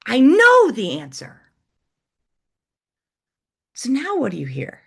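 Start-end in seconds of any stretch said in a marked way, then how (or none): 0.1-1.4 "know" punched higher and louder; excited punch on "know"; stress on KNOW. Shown as a demonstration. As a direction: hit "know" hard and high. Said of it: "change the next word".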